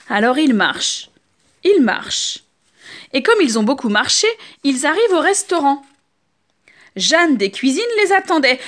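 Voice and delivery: usual speaking voice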